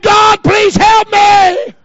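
high, loud, slow pleading cry; impassioned imitation of a desperate prayer; retold cry for help. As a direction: wail the testimony's plea like a revival shout. Retelling a lady's testimony.

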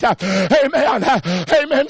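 ordinary talk

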